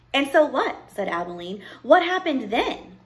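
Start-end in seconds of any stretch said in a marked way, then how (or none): 0.1-0.9 lightly voiced impatient child, higher and louder; impatient child listener. Abilene interrupts the storyteller, impatient. 1.8-3.0 high, loud, drawn-out question; eager child listener. Acted. Abilene begs the storyteller to go on, eager.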